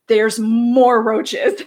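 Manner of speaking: drawn out slowly; slow, weighty punchline; slow dramatic. Real, not acted.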